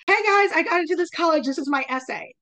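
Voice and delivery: high, quick, loud vlog greeting; student YouTuber. Retold or imitated. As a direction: imitate a student announcing an acceptance online. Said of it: "the student will say".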